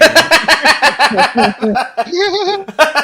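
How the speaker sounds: high, loud extended laughter; long laugh. Real, not acted. Game master laughs at the player's line, long loud laugh. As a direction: laugh hard.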